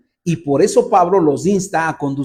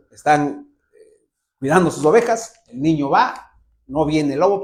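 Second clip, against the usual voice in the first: ordinary talk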